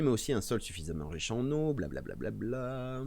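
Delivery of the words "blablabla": slow, drawn-out bored blah blah; dismissive blah blah. Retold or imitated. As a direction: brush it off lazily. Waving away the rest of the list.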